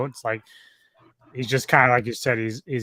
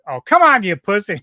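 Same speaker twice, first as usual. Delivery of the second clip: put-on much higher, much louder drunken taunt; taunting yell; 9 semitones higher, 12 dB louder than usual. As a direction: dare him to hit harder, shouting. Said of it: "then he's like".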